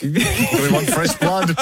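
slow, hammed-up demand for fresh blood; mock big-money donor voice. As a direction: play a pushy donor, drawn out.

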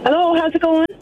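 cheery hello in an Irish accent. Real, not acted. Friend taking the phone from the caller, Irish accent. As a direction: greet them with an Irish lilt. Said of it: "a bit of an accent".

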